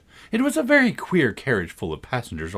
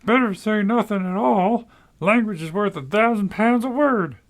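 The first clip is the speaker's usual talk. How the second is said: high, louder crowd voice; chorus thinking; 11 semitones higher, 5 dB louder than usual. A narrator's reading. Passengers thinking in chorus at Alice, much higher. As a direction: everyone thinking the same warning. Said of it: "they all thought in chorus".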